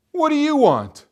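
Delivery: high, loud, sharp demand; irritated young woman. A narrator's reading. Young woman answering a soldier's knock, irritated and loud. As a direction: challenge the stranger at the door loudly.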